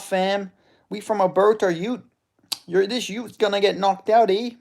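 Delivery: high, loud hammed-up Canadian threats; trash-talk like a cartoon Canadian, high-pitched; Canadian accent. Imagined Canadian fighter boasts he'll knock the youth out, mock Canadian accent. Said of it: "I'm just picturing this Canadian guy".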